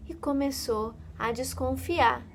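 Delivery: ordinary delivery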